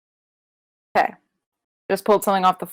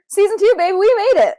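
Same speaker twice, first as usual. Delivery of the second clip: excited; 11 semitones higher, 5 dB louder than usual. Real, not acted. Celebrating season premiere.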